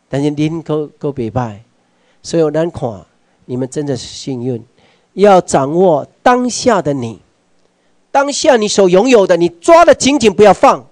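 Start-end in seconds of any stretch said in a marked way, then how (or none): 5.1-10.8 rising rallying cry. Real, not acted. Speaker urging the audience to hold on tight, rising loud exhortation. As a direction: whip the crowd up, climbing to a peak on 紧紧.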